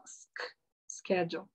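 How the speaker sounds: "sch-" then "schedule" said softly, sounding out the sk start; slow sound-out. Shown as a demonstration. Teacher sounding out "schedule" for students. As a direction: break "schedule" into sk plus the rest. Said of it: "Então, schedule é S C H".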